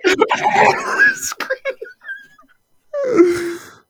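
slow, high, laughing; high wheezing laugh. Real, not acted. Friend laughing helplessly about the clip. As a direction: laugh through the words.

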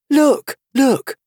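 very high, excited exclamation; eager cry. A narrator's reading. Alice suddenly spotting the Queen, crying out eagerly. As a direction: cry out to make someone look. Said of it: "she cried, pointing eagerly".

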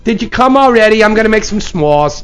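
high, loud hammed-up Minnesota accent; cheery mock climax, Minnesotan style; Minnesota coming. Host spoofing Minnesotans in bed for a sound bite, Minnesota accent. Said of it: "Minnesota coming".

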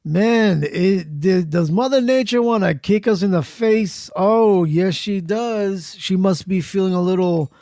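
ordinary talk, nothing marked

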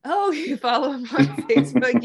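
amused disbelief, caught off guard; delighted laugh on hearing her dad is followed; laughing surprise. Real, not acted. Surprised amused reaction to Facebook remark.

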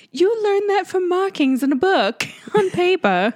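high, hammed-up British accent; playful British accent, pitched high. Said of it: "I thought I was in the U K".

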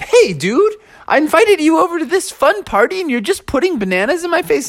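high-pitched, put-upon complaint; indignant protest. Acted. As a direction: protest like an affronted party host. Bear protests banana in face.

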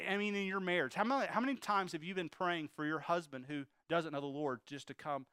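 usual speaking voice

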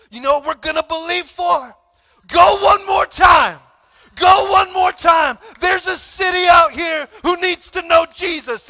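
loud, rousing preacher's cry to the congregation; full-voiced sermon exhortation; preaching at full force. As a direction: fire them up from the pulpit with shouted lines.

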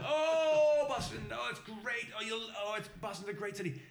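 high, gushing mock enthusiasm; long swooping cry, then gush on; overjoyed cop. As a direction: imitate a thrilled Irish cop. Acted cop gushes about Boston.

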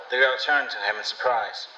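usual speaking voice

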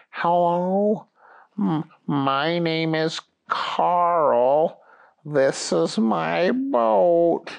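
put-on very slow, feeble old man; Carl. Ancient man greets the police, slow old voice. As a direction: take ages over each word, haltingly. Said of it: "Out came an old man".

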